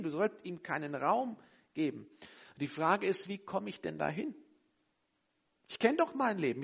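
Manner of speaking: usual speaking voice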